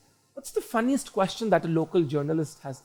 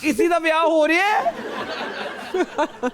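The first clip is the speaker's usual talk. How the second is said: much higher, laughing call-out; 11 semitones higher than usual. Real, not acted. Host calling out to the room through laughter, laughing.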